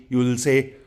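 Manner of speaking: normal talking voice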